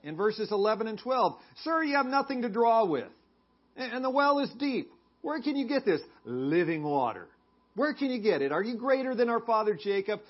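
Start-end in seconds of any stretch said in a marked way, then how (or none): 1.6-10.2 sarcastic. Acted. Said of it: "seems to have more sarcasm".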